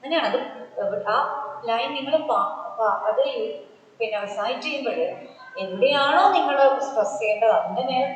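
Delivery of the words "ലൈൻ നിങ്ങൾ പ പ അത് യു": usual speaking voice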